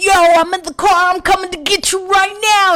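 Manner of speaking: high, loud hype gangster bravado; drunk gangster voice. Retold or imitated. Drunk caller hyping her friend up, loud gangster swagger. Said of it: "I turn into, like, a gangster".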